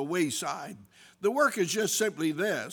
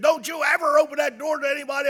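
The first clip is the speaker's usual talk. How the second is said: high, loud, fast imitated shout of an angry wife; imitated wife screaming behind the door; retold furious yell; 10 semitones higher, 6 dB louder, much faster than usual. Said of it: "she's yelling and screaming".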